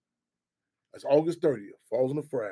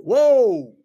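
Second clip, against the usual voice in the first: amazed whoa; 4 semitones higher, 9 dB louder than usual. Real, not acted. Reacting to the street sign.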